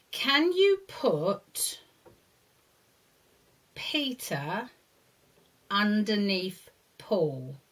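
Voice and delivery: slow drawn-out question; slow and deliberate. Shown as a demonstration. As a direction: stretch each word for small children. Teacher instruction.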